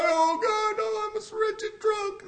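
very high, slow wailing mock plea; mock crying. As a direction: ham up a fake tearful repentance. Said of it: "come in and cry".

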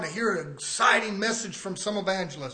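usual speaking voice

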